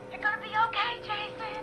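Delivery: quiet and gentle; soft reassuring. Acted. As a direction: soothe him softly. Soothing injured friend.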